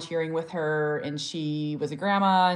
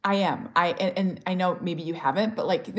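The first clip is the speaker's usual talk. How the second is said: ordinary delivery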